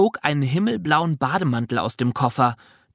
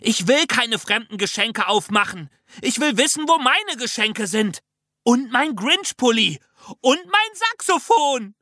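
put-on high child outburst, climbing higher to the end; upset screaming; 9 semitones higher than usual. A narrator's reading. Child missing their own presents, screaming in protest. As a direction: wail each demand higher, gulping air between. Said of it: "schrie ich".

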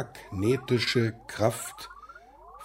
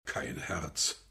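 whisper; 9 semitones lower, 11 dB quieter than usual. Acted. Alone, repeating the accusation.

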